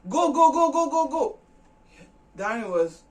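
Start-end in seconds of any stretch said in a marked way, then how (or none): 0.1-1.4 high, loud chant of go; shrill repeated go, much higher and louder; angry shouting. Retold or imitated. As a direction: drive him out the door with it. Girl ordering him out.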